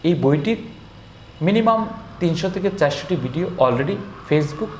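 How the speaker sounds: ordinary delivery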